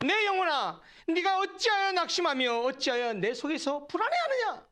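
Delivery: high, forceful scripture declamation; commanding oneself. Shown as a demonstration. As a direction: challenge your own soul with the verse. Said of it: "더 직접적으로 얘기해 볼까요?".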